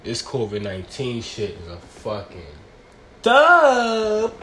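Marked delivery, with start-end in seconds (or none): 3.2-4.3 drawn out. Real, not acted. Covid is a dub.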